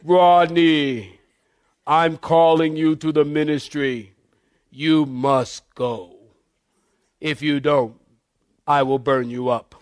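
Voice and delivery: low, slow, solemn divine command; mock-divine pronouncement, drawn out and low; God voice. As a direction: intone it deep, with long pauses, like God. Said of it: "That's my God voice".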